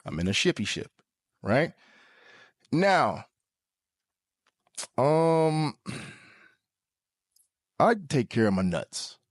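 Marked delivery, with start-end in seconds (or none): 5.0-9.2 long theatrical pause before the line; dramatic pause. Shown as a demonstration. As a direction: hold the beat, then deliver it grandly. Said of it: "Dramatic pause for real".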